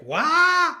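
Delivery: sudden high loud "Wah!" of awe; storyteller's wide-eyed exclamation; gasping cry of wonder. Shown as a demonstration. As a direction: burst out with a high shout of amazement. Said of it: "the only word I have for it is like".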